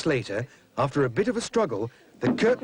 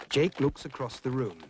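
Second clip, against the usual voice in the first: plain reading voice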